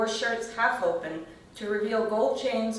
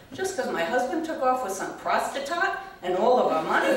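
put-on Queens accent; 3 semitones higher than usual. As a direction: play a fed-up Queens woman giving her statement in a thick Queens accent. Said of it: "With apologies to anyone who can do a real Queen's accent".

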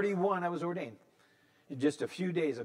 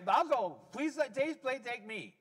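pleading prayer; 11 semitones higher than usual. Acted. Preaching at full force.

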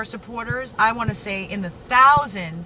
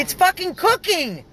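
furious outburst; 8 semitones higher than usual. Real, not acted. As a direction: spit it out in disbelief at the heat. Outrage at dying puppy.

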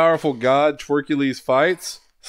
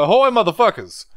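heroic call; 6 semitones higher, 4 dB louder than usual. Acted. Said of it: "what do you say?".

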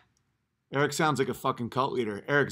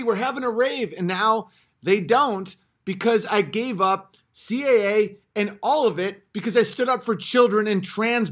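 loud furious rant at a chat commenter; angry rant; 7 semitones higher, 5 dB louder than usual. Real, not acted. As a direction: tear into him, shouting. Ranting at chat commenter Eric.

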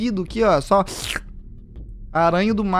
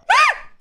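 sudden high shriek of fright; high startled scream; 4 dB louder than usual. Real, not acted. Susto em jogo de terror. (grito agudo de susto)

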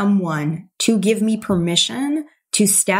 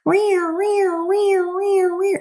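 high wailing alarm noise; imitated alarm; 13 semitones higher, much slower than usual. The fire door alarm sounding in the subway, high imitated wail. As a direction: mimic a blaring siren, high and repeated.

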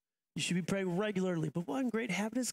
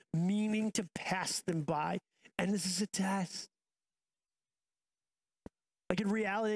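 ordinary delivery

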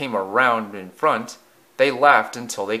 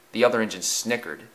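ordinary delivery, nothing marked

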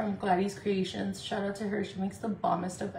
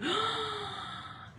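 1 sharp gasp; 12 semitones higher, 6 dB quieter than usual. Real, not acted. Shocked at friends' teasing.

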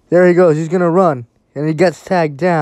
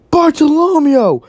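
excited call; 8 semitones higher, slower than usual. Real, not acted. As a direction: shout the catch with real joy. Big catch called live.

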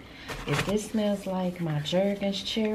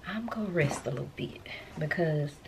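softly whispered aside; 2 semitones lower, 11 dB quieter than usual. Real, not acted. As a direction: drop into a whisper mid-thought. Quiet aside while resting.

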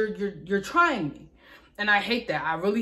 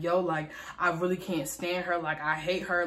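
normal talking voice

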